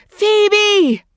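huge, high swelling shout. Acted. Mother shouts into the drain, swelling shout. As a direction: let the shout swell even bigger. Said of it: "her voice swelling".

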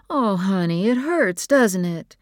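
high, lilting sympathy; motherly sympathy. A narrator's reading. Mother comforts crying, stung child.

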